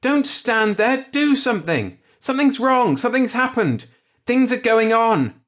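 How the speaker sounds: high, loud, pleading screams; frantic screaming. A narrator's reading. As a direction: beg the staring crowd to act. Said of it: "he screamed".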